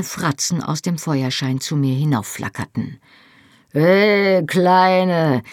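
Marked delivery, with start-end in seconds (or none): 3.7-5.4 high, loud leering shout; rowdy call. A narrator's reading. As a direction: holler up at her from the tavern. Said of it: "rief Rupert".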